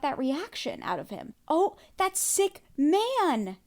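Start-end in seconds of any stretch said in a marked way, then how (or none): 1.5-3.6 higher, slow and appalled; disgusted. Real, not acted. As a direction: drag out the disgust. Disgust at Steven's smirk.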